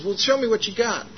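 lightly acted high-pitched prompt; prompting stranger. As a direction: imitate a man coaxing to see the work. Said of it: "And he says".

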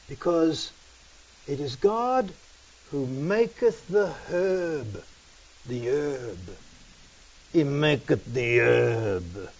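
slow, hushed, drawn-out Rastafarian pronouncement; mock-solemn Rastafarian. Preacher mimics Rastafarians justifying the herb, mock-solemn Rastafarian. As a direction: intone it softly and reverently, stretching the words.

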